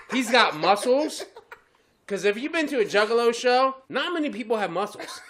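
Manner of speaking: ordinary talk, nothing marked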